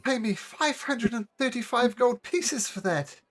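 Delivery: high, fretful halfling voice; whiny halfling. Acted. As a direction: wring your hands and whine. Halfling voice in dialogue.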